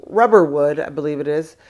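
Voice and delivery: ordinary talk, nothing marked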